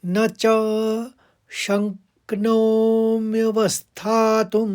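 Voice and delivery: न said lightly, च lengthened, then शक्नोम्यवस्थातुम slow; न light, च held. Shown as a demonstration. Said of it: "और न हल्के से निकालेंगे". (न हल्का, च लंबा)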